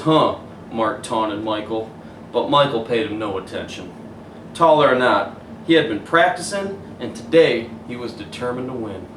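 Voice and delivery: put-on gentle storybook narration for kids; story reading. A narrator's reading. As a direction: read it like bedtime story. Reads picture book aloud.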